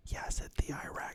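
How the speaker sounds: breathy whisper, voice dropped out; whispered aside. Real, not acted. Whispered aside mid reading.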